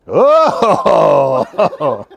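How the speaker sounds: a loud laughing oh; cracking up at his own joke; laughing exclamation. Real, not acted. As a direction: burst out laughing. Laughing cry out.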